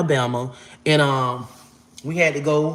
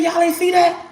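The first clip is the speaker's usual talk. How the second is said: amazed disbelief; 13 semitones higher than usual. Retold or imitated. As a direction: burst out in disbelief. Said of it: "I said".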